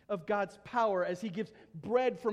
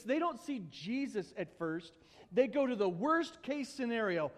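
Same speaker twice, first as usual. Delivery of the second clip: ordinary delivery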